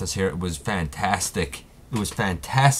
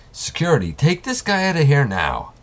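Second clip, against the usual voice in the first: higher, louder command to throw him out; ordering security; 8 semitones higher, 5 dB louder, faster than usual. Acted. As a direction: bark it at the guards. Host orders villain removed.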